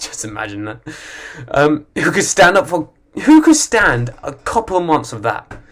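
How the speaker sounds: ordinary talk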